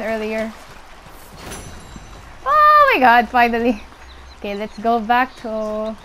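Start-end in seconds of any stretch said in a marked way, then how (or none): 2.4-3.8 thrilled relief. Real, not acted. Player finally getting what she waited for, thrilled relief.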